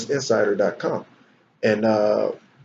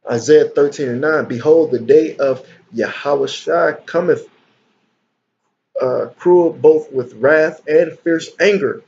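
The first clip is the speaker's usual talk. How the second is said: fiery preaching; 2 semitones higher, 4 dB louder than usual. Acted. Loud slow preaching of scripture.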